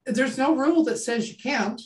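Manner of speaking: ordinary talk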